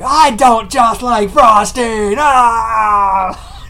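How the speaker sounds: put-on loud, high, slow toddler bawl; imitated frosting tantrum; mock crying. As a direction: wail each word, ending in a scream. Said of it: "Stop crying".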